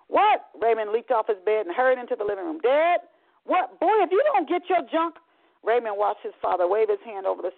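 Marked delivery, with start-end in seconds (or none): 0.1-0.5 high, loud answer from another room; calling out. Acted. Son answers a shouting father. 2.6-3.8 calling out. Acted. Son answers a shouting father. 3.8-5.2 angry father. Acted. Angry father scolds his son.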